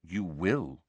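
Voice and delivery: low, soft, steady. A narrator's reading. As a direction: settle it with calm certainty. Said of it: "said Dumbledore steadily".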